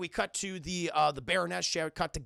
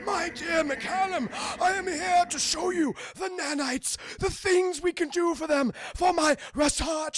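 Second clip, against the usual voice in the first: high, hammy villain declamation; the Doctor; 9 semitones higher, 4 dB louder than usual. Retold or imitated. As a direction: proclaim it grandly, stretched high and loud. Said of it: "That voice".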